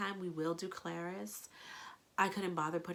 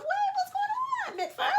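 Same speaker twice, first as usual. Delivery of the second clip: squealing; 6 dB louder than usual. Real, not acted. High squeal at the reader's character.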